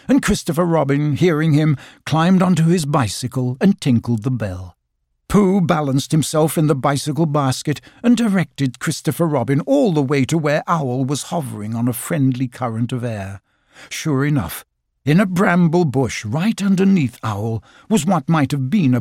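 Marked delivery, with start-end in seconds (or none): none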